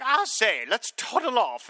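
high, hammy faux British toff; mock upper-class British accent; terrible British accent. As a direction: ham up a posh British officer. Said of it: "terrible accents".

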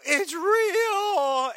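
high shrieking yell; madman scream. Retold or imitated. Albert screams at the burning house in a high shriek. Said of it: "as he's screaming".

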